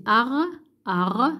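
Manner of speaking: "ar" sounded out alone, its R clearly heard; audible R. Shown as a demonstration. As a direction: draw out "ar" so the final R rings. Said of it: "on entend le R". (« ar » bien articulé, R final audible)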